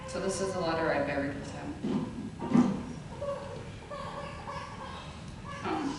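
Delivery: quiet, fighting back tears; holding back tears. Real, not acted. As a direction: voice close to crying. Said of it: "I'm trying not to cry to say it".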